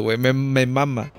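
ordinary delivery

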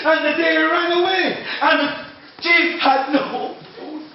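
ordinary delivery, nothing marked

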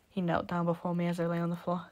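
ordinary delivery, nothing marked

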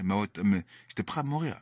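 ordinary talk, nothing marked

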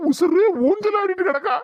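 high, startled repeat of her words; shocked echo. Acted. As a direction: throw her words back in disbelief. Son echoes in disbelief, high.

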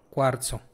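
cuarzo with a ts sound; Italian z demo. Shown as a demonstration. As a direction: softly model cuarzo with ts. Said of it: "te pusieron una".

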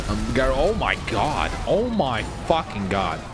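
normal talking voice

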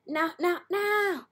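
high, rising repeated cries; imitated game sound. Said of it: "It's like".

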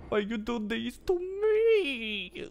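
slow, high whining cry; hammed-up sobbing plea, high and slow; mock wail. Real, not acted. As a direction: wail it out slowly like you're about to sob. Despair after a failed hit.

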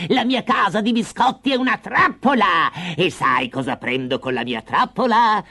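high, shrieking witch voice; cackling witch gloating over her trap; gleeful witch shriek. Acted. The witch traps Hansel and shrieks with glee. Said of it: "la vecchia strillò di gioia".

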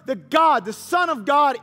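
ordinary delivery

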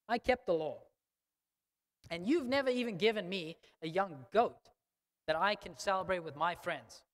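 loud, indignant son scolding his father; disrespectful rant. Retold or imitated. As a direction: lay into him like a resentful son. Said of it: "how disrespectful that is".

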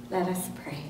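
ordinary delivery

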